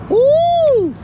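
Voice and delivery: high, loud whoop; a sudden high cheering woo; mock woo. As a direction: whoop like a thrilled nurse. Nurses cheering at shirtless patient.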